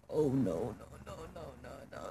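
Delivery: low, very soft string of no's; dreading murmur. Retold or imitated. Girl watching a boy lean in to kiss, hushed dread. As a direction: sink into a hushed, low panic.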